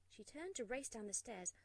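ordinary delivery, nothing marked

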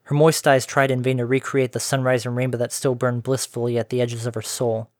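ordinary delivery

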